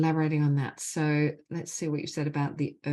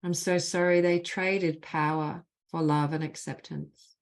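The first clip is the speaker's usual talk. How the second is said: measured, deliberate leading of a phrase; guided healing phrases; 2 semitones higher than usual. Acted. Facilitator gives the group apology phrases to repeat, measured and deliberate. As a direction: lead the group line by line, leaving space after each.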